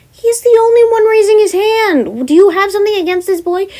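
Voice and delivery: high, loud, incredulous; indignant protest. Acted. Wizard protesting to the teacher about the boy, high and indignant. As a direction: push back in disbelief.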